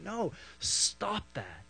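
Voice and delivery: soft, high, drawn-out "no, stop that"; hushed mock plea; acted pleading objection. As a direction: slowly urge him to stop, keeping it soft. Acted gentle objection to Paul.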